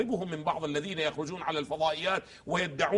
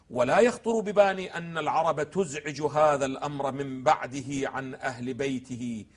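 slow, drawn-out quoted text; slow recitation; 5 semitones lower, slower than usual. Acted. Lecturer quoting the sermon to the host, slow recitation. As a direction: read the Imam's words slowly, weighing each.

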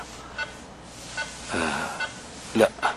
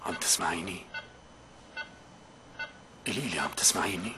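hushed, pleading question; quiet; 11 dB quieter than usual. Acted. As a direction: ask softly into the silence. Asking a silent voice, hushed.

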